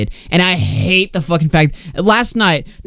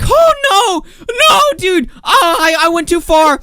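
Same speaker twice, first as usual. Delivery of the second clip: high whiny crying mock voice; hammed-up sobbing panic of the chat kids; 12 semitones higher, slower than usual. Group chat members in mock tearful panic.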